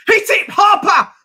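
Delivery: high, loud yell at the player; shouting. Real, not acted. Fan yelling at the screen for Harper to shoot, high loud shout. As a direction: bellow him on to shoot.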